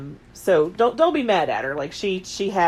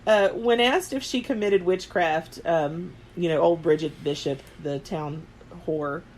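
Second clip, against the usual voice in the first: ordinary delivery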